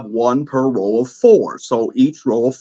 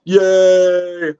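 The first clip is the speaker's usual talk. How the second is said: cheering; 6 semitones higher than usual. Real, not acted. Cheering after the rules.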